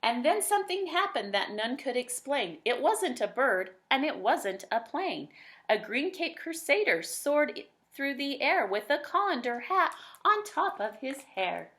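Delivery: lilting rhymed read-aloud; bouncy rhyming narrator voice; storybook narration. A narrator's reading. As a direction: tell it like a bedtime picture book.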